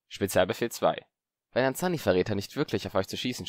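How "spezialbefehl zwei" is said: ordinary delivery, nothing marked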